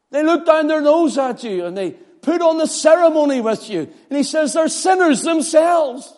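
high, loud preaching voice; fiery preaching. Acted. Preacher voicing Paul against the legalists, fervent preaching. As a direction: preach it with rising fire.

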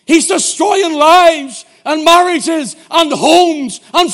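slow, high, bellowed verse; shouted scripture. Retold or imitated. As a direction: thunder it out word by word. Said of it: "And I went".

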